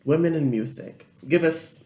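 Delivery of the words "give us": usual speaking voice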